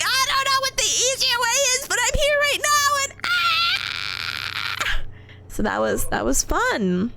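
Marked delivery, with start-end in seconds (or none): 0.0-5.0 retold panicked yelp.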